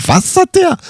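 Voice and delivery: high-pitched baffled question; mock incredulous. Husband baffled by Susi's warranty joke, mock incredulous. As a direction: squeak it out in acted disbelief.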